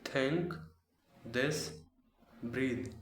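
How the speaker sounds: "Think" said like tank, "this" like dis, "breathe" like breed; TH turned into t and d sounds; careful learner attempt at TH; learner repeating TH words. Shown as a demonstration. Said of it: "repeat after me".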